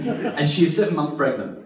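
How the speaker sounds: normal talking voice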